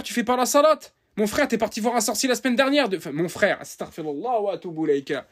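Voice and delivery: usual speaking voice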